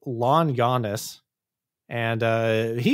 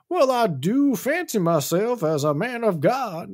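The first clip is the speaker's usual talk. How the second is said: grandly self-satisfied, higher put-on voice; Yancey; 8 semitones higher than usual. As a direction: play a pompous would-be preacher, pitched high. Yancey accepts minister role, acted.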